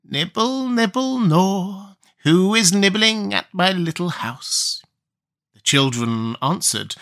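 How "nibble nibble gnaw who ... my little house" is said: high, slow creaky witch voice; drawn-out crooning witch; witch's call. Acted. Witch voice inside the house.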